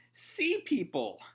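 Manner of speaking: high, slow dumb alarm; a dimwit blurting out his panic; slow, high goofball exclamation; goofy panicked cry. Retold or imitated. Shiki panics at monster.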